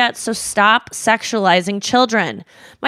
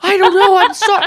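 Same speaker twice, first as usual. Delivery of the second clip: high, louder flustered fumble; flustered dad; 12 semitones higher, 6 dB louder than usual. Retold or imitated. Dad flustered after his comment, high and louder. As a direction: imitate him caught off guard and sputtering. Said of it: "And he was just like".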